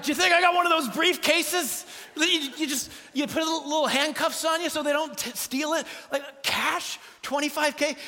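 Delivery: high, loud comic indignation; incredulous outburst. Retold or imitated. Dad answering the clerk's cash question, loudly incredulous.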